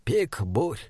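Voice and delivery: softly voiced, no feeling shown; expressionless. A narrator's reading. Troll names the peak to the others, expressionless. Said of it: "Голос Бинбика был абсолютно невыразительным".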